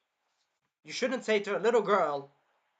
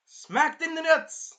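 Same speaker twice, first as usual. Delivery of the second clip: much higher, louder and slow, savoring it; gleeful gloating; 11 semitones higher, 8 dB louder, much slower than usual. Real, not acted. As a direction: drag out the glee at his pain. Gloating over villain's pain.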